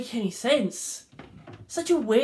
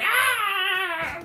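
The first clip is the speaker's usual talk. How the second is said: high short cry as she falls; falling scream; 12 semitones higher than usual. Retold or imitated. Henchwoman kicked out of the car, high falling cry. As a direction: yelp as if flung out.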